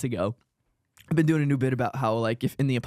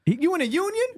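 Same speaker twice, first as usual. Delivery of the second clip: mock comic voice. Mocking the comic together.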